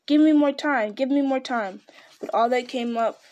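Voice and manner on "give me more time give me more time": raised, louder plea for time; higher, urgent cry; shout. A narrator's reading. Bilbo desperate to stall Gollum, shouting. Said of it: "He wanted to shout".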